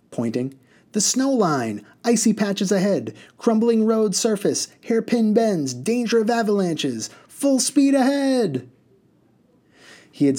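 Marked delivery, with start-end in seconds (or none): 0.9-8.7 gleeful driver calling hazards. A narrator's reading. Mister Butt announcing dangers to terrified passengers with glee. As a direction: relish each danger.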